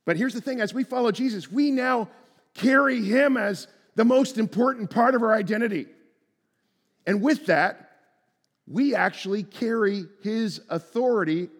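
fervent sermon climax; preaching at full force. Acted. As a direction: proclaim it with conviction.

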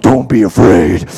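very low, slow, booming gravel-free growl of "Don't be afraid"; Batman voice. Pretend. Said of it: "like a Batman voice?".

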